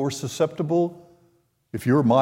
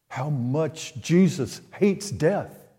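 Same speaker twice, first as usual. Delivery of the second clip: ordinary talk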